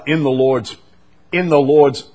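ordinary delivery

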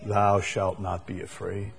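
soft, gentle reassuring promise. Shown as a demonstration. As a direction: say it like a tender promise to a child. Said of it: "I like it as a promise".

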